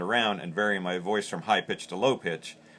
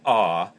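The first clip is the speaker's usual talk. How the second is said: goose moan; 7 dB louder than usual. Shown as a demonstration. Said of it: "you open that hand up".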